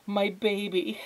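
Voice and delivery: high-pitched relieved mother; doting mother. Acted. Mother takes Flora in her arms, doting. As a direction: coo it high over the found child. Mother embraces found daughter.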